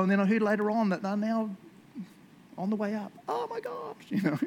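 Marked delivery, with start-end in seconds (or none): 3.3-4.0 amazed squeal. Pretend. Believer amazed their prayer worked, squealing high. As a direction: squeal it like you can't believe it.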